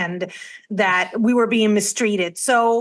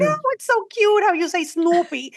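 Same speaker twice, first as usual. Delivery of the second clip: high-pitched mimicked cooing; adoring coo; 8 semitones higher than usual. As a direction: coo it like charmed coworkers, much higher. Mimicking coworkers cooing over her accent.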